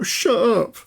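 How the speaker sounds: high-pitched mock yell; impression of irate driver. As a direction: squawk it like the angry driver. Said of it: "exactly that voice as well".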